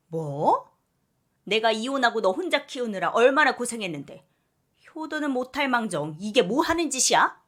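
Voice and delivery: loud, outraged mother yelling; mother exploding at her ungrateful daughter; screaming outrage; furious shout. Acted.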